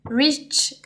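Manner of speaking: a playful, slightly exaggerated English accent on the product name; light tongue-in-cheek English accent. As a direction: say the name like a mock English speaker. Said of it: "avec mon super accent anglais".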